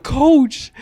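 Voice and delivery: high, loud startled "Coach."; startled call. Retold or imitated. Player startled seeing coach crawl on floor, high shocked call. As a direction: blurt it out in shock.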